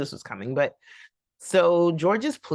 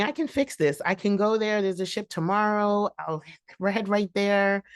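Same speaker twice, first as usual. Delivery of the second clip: eager wife; 6 semitones higher than usual. Retold or imitated. Bertha voiced again.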